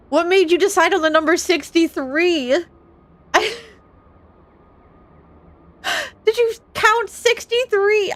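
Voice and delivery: high-pitched, loud disbelief; incredulous outburst. Real, not acted. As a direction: demand an answer, voice shooting up. Incredulous at a teasing tip amount.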